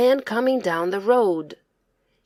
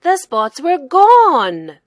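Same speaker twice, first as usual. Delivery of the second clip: slow build to a loud burst; dramatic reveal; 5 semitones higher, 9 dB louder, slower than usual. A narrator's reading. Narrator reveals the healing to listening children. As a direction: hold back, then let it ring out.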